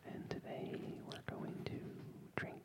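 whispered intro; hushed, breathy aside. Pretend. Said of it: "Why are you doing that?".

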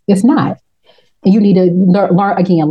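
ordinary talk

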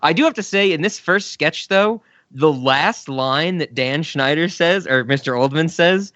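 usual speaking voice

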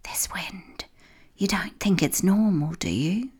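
hushed, secretive whisper; whispered suspicion; barely a whisper. A narrator's reading. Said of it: "barely a whisper".

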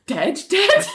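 high, comic disbelief; incredulous question. Retold or imitated. John counsels Richard in his office, high incredulous question. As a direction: pitch it up, half laughing. Mimics shocked reaction in counselling.

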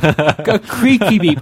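creaky stretched slow and loud; drawn-out creaky. Shown as a demonstration. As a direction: draw out A creaky beep slowly. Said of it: "lack of creakiness in my explanation".

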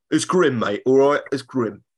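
lightly voiced grumpy Cockney bloke; mock Cockney. A hint of a Londoner complaining about grim weather in Cockney. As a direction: grumble it like a Londoner fed up. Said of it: "Ray Winston's not doing much".

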